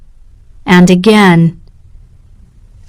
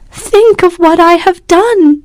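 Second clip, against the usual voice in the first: much higher, distressed plea; crying out; 9 semitones higher than usual. A narrator's reading. Catherine crying out to Morris, distressed. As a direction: cry out in despair. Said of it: "she cried".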